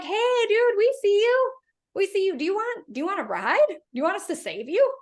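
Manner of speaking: high, bright hail from a passing boat; calling out to stranded man. Retold or imitated. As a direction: call out cheerfully from the deck. Sailboat crew calling out to man.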